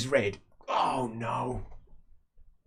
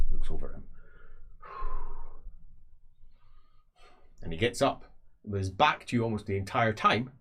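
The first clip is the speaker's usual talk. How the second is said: normal talking voice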